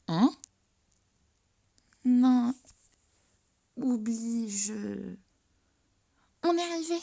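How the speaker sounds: high, loud flustered deflection; hurriedly change the subject. Acted.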